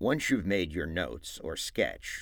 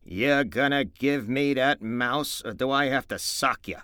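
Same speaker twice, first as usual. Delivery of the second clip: cold; 6 semitones higher, 7 dB louder than usual. Acted. George to Lenny, threatening coldly to make him hand it over. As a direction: make the threat level and hard. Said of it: "George said coldly".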